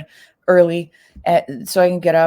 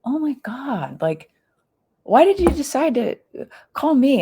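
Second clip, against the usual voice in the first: half-laughing shock at an odd call; replaying a stunned question; retold surprise; 8 semitones higher than usual. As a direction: voice jumping up high in disbelief. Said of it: "And I'm like".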